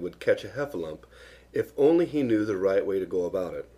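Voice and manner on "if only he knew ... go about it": ordinary delivery, nothing marked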